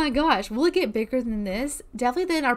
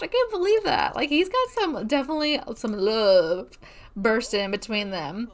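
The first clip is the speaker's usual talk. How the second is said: ordinary talk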